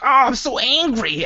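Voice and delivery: high, slow, hammed-up sulk; mock angry whine. Host mimics a sulking adult with a mock angry whine. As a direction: whine it like a cranky child. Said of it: "and you're like".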